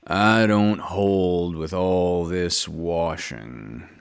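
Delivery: low, slow, gloomy donkey grumble; grumbling Eeyore. A narrator's reading. Narrator voices Eeyore grumbling about washing, low and slow. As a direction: drag it out like a glum old donkey. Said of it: "grumbled Eeyore".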